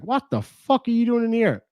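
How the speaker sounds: mock outrage at finding him; startled intruder. The guy in the show finding Buzz in a coffin, mock outraged.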